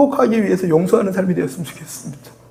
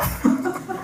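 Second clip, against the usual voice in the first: breathy laugh; 8 dB quieter than usual. Real, not acted. Laughs at his own prayer.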